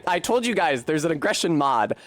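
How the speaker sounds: ordinary talk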